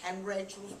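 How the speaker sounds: ordinary delivery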